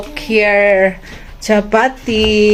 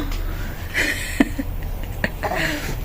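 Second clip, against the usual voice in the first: 1 breathy silent laugh; 6 semitones higher, 10 dB quieter than usual. Real, not acted.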